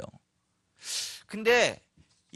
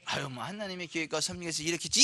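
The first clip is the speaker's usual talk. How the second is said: resigned believer; 6 dB quieter than usual. Acted. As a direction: give in with a quiet shrug in the voice. Voices a passively resigned believer.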